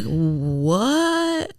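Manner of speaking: soft, long drawn-out baffled "what"; quietly dumbfounded, word dragged out. Retold or imitated. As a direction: stretch it out low and slow in disbelief. Acted baffled reaction to comments.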